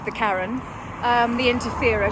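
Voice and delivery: ordinary delivery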